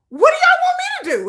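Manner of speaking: high, quick mock exasperation; exasperated producer. Retelling the producer's reply to flooding fans, exasperated.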